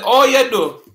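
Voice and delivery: as if greeted on the street; Jamaican patois. Shown as a demonstration. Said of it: "somebody walk up to you and say".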